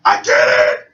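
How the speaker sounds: strained, voiceless squeal of triumph; hammed-up stunned victory; mock amazement. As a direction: gasp it out in disbelief. Retold amazed triumph in game.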